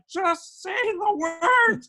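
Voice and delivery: high, loud and slow, losing patience; a director at the end of his rope; drawn-out mock frantic shout; exasperated yell. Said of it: "losing his mind".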